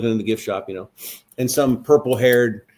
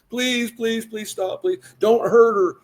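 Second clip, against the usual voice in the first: pleading parents; 9 semitones higher, slower than usual. Retold or imitated. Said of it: "Here come her conservative parents".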